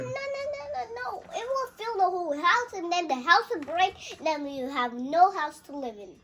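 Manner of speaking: loud, high, worked-up protest; excited protest. Real, not acted. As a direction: shout it out in alarm. Child protests loudly about boxes.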